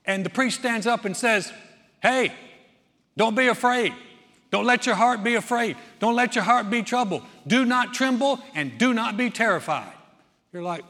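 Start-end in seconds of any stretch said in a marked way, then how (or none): 2.0-9.9 priest's rally. Acted. Priest rallying soldiers before battle.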